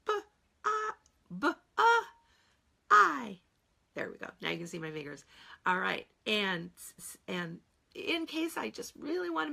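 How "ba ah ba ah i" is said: ba and I sung out high and loud, syllable by syllable; sounding it out. Shown as a demonstration. Teacher loudly sounding out the syllables for the class. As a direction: stretch out ba, ah, ba, ah, I. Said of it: "Sound it out".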